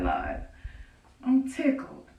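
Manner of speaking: usual speaking voice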